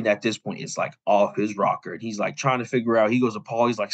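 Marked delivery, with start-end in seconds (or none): none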